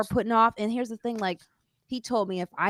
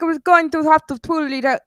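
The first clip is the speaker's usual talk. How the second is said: put-on a half-hearted Russian accent, higher and louder; weak mock Russian accent; failed Russian accent; 8 semitones higher, 6 dB louder than usual. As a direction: try a Russian accent and miss. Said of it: "I can't do a Russian accent".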